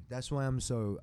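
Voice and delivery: ordinary talk, nothing marked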